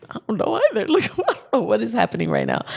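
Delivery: high-pitched, laughing confusion; retold bewilderment. Retold bewildered answer to worried ex.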